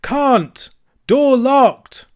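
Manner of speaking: high, spaced-out shouts; slow, loud reply. A narrator's reading. Cyril explaining from the tower, slow and loud. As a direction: holler it down in separate bursts. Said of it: "the same voice replied".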